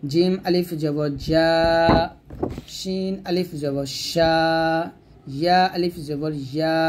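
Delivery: slow drill, জা, শা, ইয়া held long; mid-tongue madd. Shown as a demonstration. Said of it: "জিহ্বার মধ্যখান থেকে".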